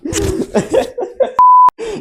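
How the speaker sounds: shrieking laughter; high laughing fit. Real, not acted. Bursts out laughing.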